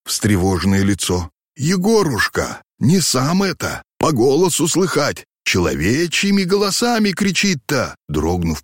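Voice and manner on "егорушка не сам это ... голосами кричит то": put-on high, fearful old man; frightened babble. A narrator's reading. Frightened old man to his coachman about human voices, fearful. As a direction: plead in a high, scared voice. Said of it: "пролепетали побелевшие от страха губы".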